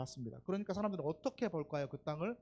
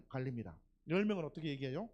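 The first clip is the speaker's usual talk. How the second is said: normal talking voice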